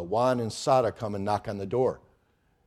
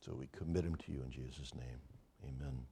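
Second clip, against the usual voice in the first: hushed, much softer praying voice; prayer; 9 semitones lower, 16 dB quieter than usual. Real, not acted. Pastor praying softly over the young people, hushed prayer.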